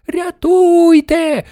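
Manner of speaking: very high, long-drawn scream; grandmother's piercing cry for help. A narrator's reading. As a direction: scream for help, stretching every syllable. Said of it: "верещала бабуня Джорджина".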